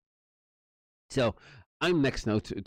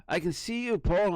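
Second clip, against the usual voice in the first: higher sing-song mock officer; teasing officer; 9 semitones higher than usual. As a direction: play the cop calling through the letterbox. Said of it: "he's going".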